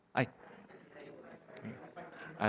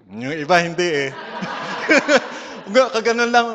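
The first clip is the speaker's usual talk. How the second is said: laughing; 4 semitones higher, 6 dB louder than usual. Real, not acted. Laughing, mutilated-bills joke.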